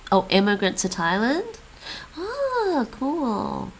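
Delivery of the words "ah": ordinary talk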